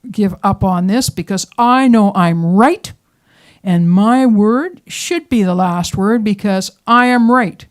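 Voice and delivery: wife on the canal bank, loudly vowing never to give in; defiant wife. Retold or imitated. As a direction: dig in and refuse to give up. Wife vowing to win argument.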